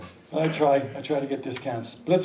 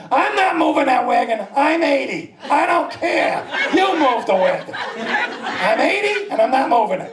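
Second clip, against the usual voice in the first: higher, hammed-up elderly defiance; stubborn old lady; 6 semitones higher than usual. Old lady refusing to move her wagon, stubborn. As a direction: dig your heels in as a cranky old woman. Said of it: "Eighty is the little old lady".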